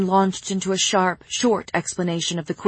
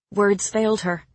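usual speaking voice

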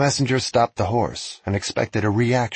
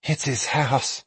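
slow, higher awed voice; awed young messenger; 4 semitones higher, slower than usual. Acted. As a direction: breathe it out in awe. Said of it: "the messenger said".

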